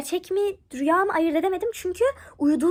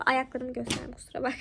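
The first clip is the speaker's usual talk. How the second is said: an apologetic whisper; whispered aside; 2 semitones lower, 5 dB quieter than usual. Real, not acted. Apologising to viewers while showing feet, whispered.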